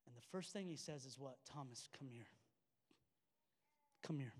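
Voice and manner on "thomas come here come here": soft, tender invitation. Retold or imitated. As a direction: quietly draw him close. Jesus invites Thomas tenderly.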